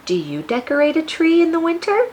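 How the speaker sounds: normal talking voice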